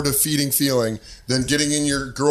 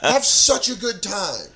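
high, drawn-out fake cheer; mock enthusiasm; 9 semitones higher, slower than usual. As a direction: send her off with exaggerated, high-pitched delight. Feigned cheer at girlfriend's party plan.

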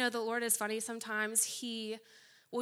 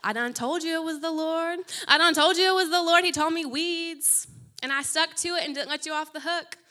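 much louder, higher triumphant gloat; 6 semitones higher, 10 dB louder than usual. Retold or imitated. Telling the friend she was right, gloating loudly. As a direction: crow in victory, loud. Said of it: "from gloating".

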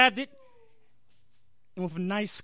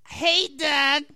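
put-on high, loud raspy girl voice; raspy stupid voice; 7 semitones higher, 6 dB louder than usual. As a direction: croak it like a lifelong smoker. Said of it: "with her raspy, stupid voice".

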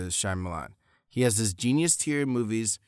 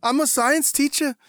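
Boston Wahlberg impression; 6 dB louder than usual. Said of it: "Mixed with his Boston accent".

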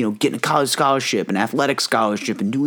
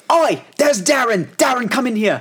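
Australian trainer; 11 semitones higher than usual. Retold or imitated. Trainer hollering at Darren to join, mock Australian. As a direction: ham up the Australian fitness coach calling someone in. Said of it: "this Aristotle Australian guy".

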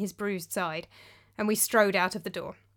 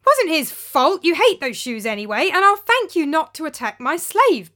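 angry defence; 8 semitones higher, 9 dB louder than usual. Acted. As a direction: stand up to him hotly. Narrator defending slave angrily.

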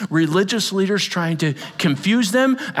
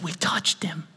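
whispered testimony; 8 dB quieter than usual. Acted. Whispered quote of John.

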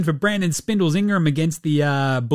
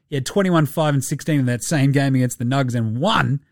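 usual speaking voice

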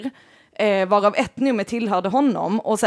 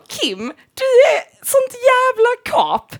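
emphatic plea; 13 semitones higher, 4 dB louder, much slower than usual. Real, not acted. Insisting to a friend, near tears.

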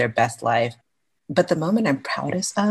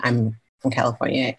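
ordinary talk

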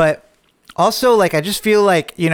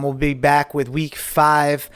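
ordinary talk

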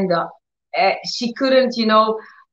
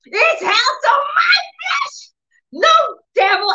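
angry. Retold or imitated. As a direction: imitate her shrieking in defiance, word by word. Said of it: "really got angry".

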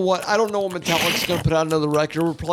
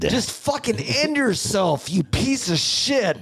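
mock rant; 8 semitones higher, slower than usual.

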